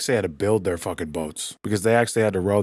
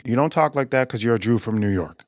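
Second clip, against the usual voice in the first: ordinary delivery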